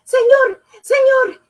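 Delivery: high loud breathless calling; boy wheezing at the carpenter; wheezed. Acted. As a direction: gasp it out after running. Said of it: "he wheezed".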